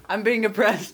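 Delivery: high whiny protest; hammed-up victim cry; mock outrage. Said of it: "Derek's trying to suppress me".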